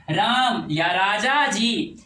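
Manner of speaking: slow राम and राजा जी with stretched vowels; long drawn call. Shown as a demonstration. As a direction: call them out, holding each vowel. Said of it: "यानी लंबा स्वर".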